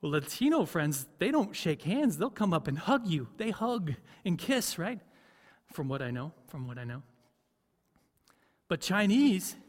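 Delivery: ordinary talk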